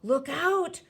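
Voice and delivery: high, urgent cry of warning; cried warning. A narrator's reading. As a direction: cry out to warn of danger. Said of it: "cried the B F G".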